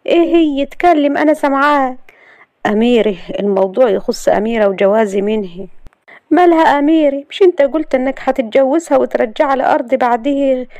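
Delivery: put-on high young woman's voice, sharp at Amira; young wife. A narrator's reading. Layla reacting to Galal's talk of Amira, high and sharp.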